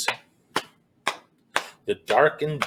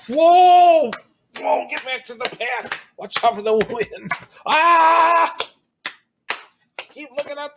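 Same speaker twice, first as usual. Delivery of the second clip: much higher, cries of whoa over the wind; hammed-up wind panic; 11 semitones higher, 4 dB louder than usual. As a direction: shout against a pretend gale. Pretend strong wind in woods.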